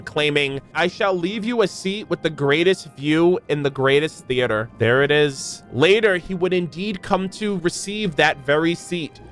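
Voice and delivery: ordinary talk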